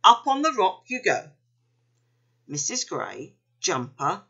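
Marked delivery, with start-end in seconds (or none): 0.0-1.3 firm, higher-pitched command; brisk-mannered instruction, voice lifted; ordering. A narrator's reading. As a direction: send them up with an order. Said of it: "he ordered".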